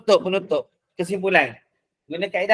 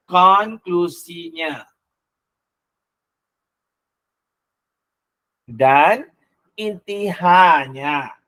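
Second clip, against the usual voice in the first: slow dictation; much slower than usual. Shown as a demonstration. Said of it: "spaced teaching".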